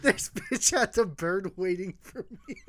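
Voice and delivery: helpless laughter breaking up the words; cracking up mid-story; laughing while talking. Real, not acted. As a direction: tell it through giggles.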